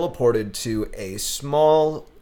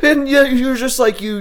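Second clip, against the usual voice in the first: usual speaking voice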